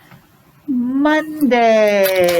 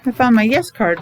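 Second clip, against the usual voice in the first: ordinary talk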